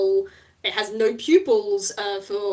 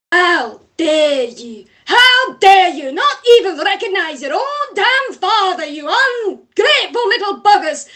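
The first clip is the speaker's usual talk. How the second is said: furious scolding; 9 semitones higher, 9 dB louder than usual. Acted. Floating father head scolding his sons, furious and loud. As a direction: bellow at his ungrateful sons.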